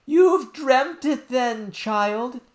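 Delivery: high, loud, very slow nurse voice; dismissive nurse. Acted. Nurse dismissing the princess's story, loud and slow. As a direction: brush the child off in a high drawn-out voice.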